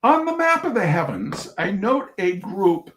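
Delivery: measured reading-aloud voice for an audience; story narration. Acted. Reading a humorous essay to an audience, storyteller narration. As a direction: read the essay out with dry humor.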